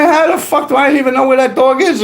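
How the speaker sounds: mock outrage at the accusation; retold indignant outburst. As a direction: replay the moment you were accused and blow up. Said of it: "I'm like".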